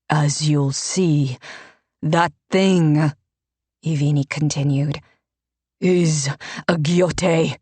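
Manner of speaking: lightly voiced pious priest; a hint of a preaching priest. A narrator's reading.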